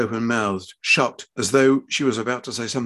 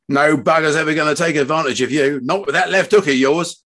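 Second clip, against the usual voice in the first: loud, raised fatherly proclamation; hearty declaration; 4 semitones higher, 7 dB louder than usual. A narrator's reading. Father reassuring his daughter, loud hearty declaration. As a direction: belt it out with conviction. Fred's proud closing declaration.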